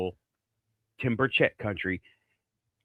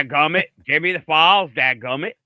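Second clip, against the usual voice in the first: mock-indignant East Tennessee twang; East Tennessee accent; 3 semitones higher, 7 dB louder than usual. Podcaster mimicking the congressman's accent for her co-host, East Tennessee accent. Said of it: "I like his accent. I love his accent".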